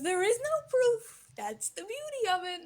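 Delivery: high, loud theatrical gloating; gleeful villain. Acted. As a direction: proclaim it high, delighted by your own scheme. Villain gloats over his scheme.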